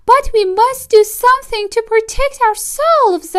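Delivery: wife defending the fox to her husband, squeaky mouse voice; small mouse wife. Acted. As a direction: pipe it up like a tiny mouse lady. Mrs DeSoto's voice.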